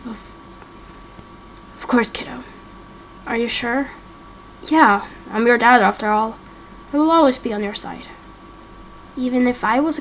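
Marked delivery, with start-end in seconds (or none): 0.1-8.1 lightly voiced, reassuring father; a hint of a steady dad; softly reassuring dad. Plays the dad figure.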